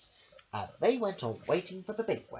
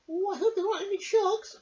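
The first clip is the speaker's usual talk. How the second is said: much higher, squeaky little lady voice; Missus Armitage voice; 5 dB louder than usual. Acted. Missus Armitage asks her dog a question, high and squeaky. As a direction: pipe it up like a fussy little lady. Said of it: "said Missus Armitage".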